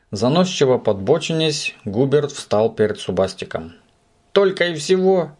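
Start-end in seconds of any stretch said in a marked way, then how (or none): 4.3-5.3 high scornful put-down; disdainful. A narrator's reading. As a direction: sneer at his little trick. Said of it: "пренебрежительно осведомился".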